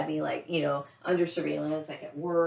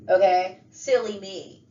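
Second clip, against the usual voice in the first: usual speaking voice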